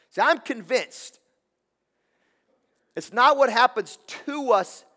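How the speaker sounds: fired-up preacher pleading with the congregation; preaching at full force. Acted. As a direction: lift it up to the back row.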